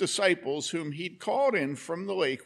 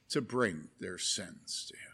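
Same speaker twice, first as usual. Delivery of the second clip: hushed whisper; 9 semitones lower, 8 dB quieter than usual. Acted. Tender moment of forgiveness.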